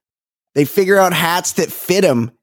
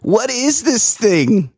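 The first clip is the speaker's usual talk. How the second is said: slow, higher-pitched imitation of confusion; mock bafflement; 6 semitones higher, slower than usual. Retelling his reaction to the hat, mock bafflement. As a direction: stumble into it, mimicking a baffled man. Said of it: "he's like".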